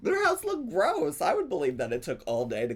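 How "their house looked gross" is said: disgusted. Real, not acted. Mocking disgust at house.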